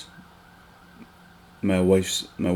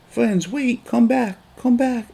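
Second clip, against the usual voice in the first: put-on little son calling after kids; slower than usual. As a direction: call after them like a small child left behind. Son chasing kids who walk away.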